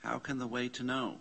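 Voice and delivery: fast, even read-through of the question; quick question. Shown as a demonstration. Teacher rereads the question fast for the class. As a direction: rattle off How can the way to know. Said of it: "Question mark".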